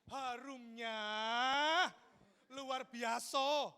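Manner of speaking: drawn-out, swooning exclamation; hammed-up admiration. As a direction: savour the scent, stretching every syllable theatrically. Acting overwhelmed by woman's perfume.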